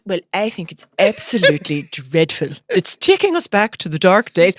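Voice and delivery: lightly posh, proper phone-in voice; posh interview voice. Retold or imitated. Mum turns posh for the radio reporter, lightly. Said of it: "And then she went".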